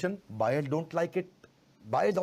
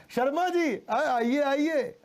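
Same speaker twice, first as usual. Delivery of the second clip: loving call, a little higher; warm greeting; 8 semitones higher, 3 dB louder, much slower than usual. Retold or imitated. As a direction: welcome the neighbour fondly. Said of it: "आपने उन्हें प्यार से बोला".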